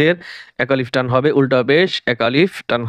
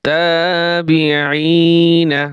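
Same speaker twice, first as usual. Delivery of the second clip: slow drawn-out reading; 3 semitones higher, much slower than usual. Shown as a demonstration. Teacher to students, showing how to blend the syllables, slow chanted reading. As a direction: stretch every syllable, very slow and sung out. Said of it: "বানান করে পড়বেন".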